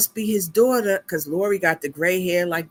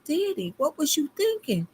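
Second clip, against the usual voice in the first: retold exasperation; 8 semitones higher, 3 dB quieter than usual. Retold scolding of her father.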